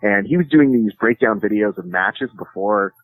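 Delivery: normal talking voice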